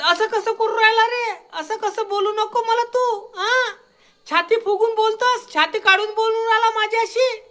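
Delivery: high, tearful, whiny mockery; mock whining complaint. Mocking the offended Sachin Sathe as whiny and tearful. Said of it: "आणि म्हणत".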